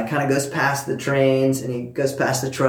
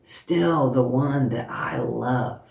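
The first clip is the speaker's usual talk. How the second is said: tender voice of God; 5 dB quieter, slower than usual. Retold or imitated. God's words spoken to a daughter.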